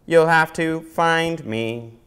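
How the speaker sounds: slow, even mechanical robot request. Acted. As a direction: speak like a polite robot. Robot teases from afar.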